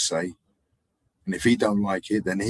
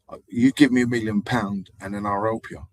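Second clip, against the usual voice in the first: ordinary talk, nothing marked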